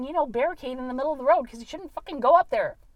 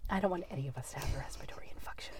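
unvoiced, much softer and fast; whispering; 7 semitones lower, 19 dB quieter, faster than usual. Real, not acted. Friend whispering a joke about infections. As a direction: whisper it quickly.

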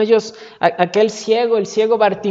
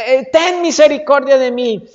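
loud, raised cry for mercy; shouted plea; 4 semitones higher, 6 dB louder than usual. Acted. Blind man who cannot see where Jesus is, shouting a plea. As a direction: beg at the top of the voice. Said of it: "más fuerte gritaba".

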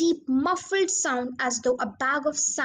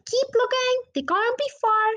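urgent; 11 semitones higher, 4 dB louder than usual. A narrator's reading. Father urging search in wood.

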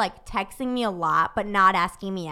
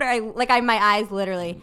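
usual speaking voice

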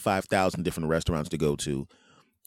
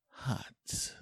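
"hot" whispered after a gasp; breathed emphasis; 10 dB quieter than usual. Shown as a demonstration. Host relishing hot breakfast, whispered. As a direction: let "hot" out as a soft whisper.

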